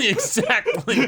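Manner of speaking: cracking up mid-word; laughing. Real, not acted. Cohost agreeing while laughing.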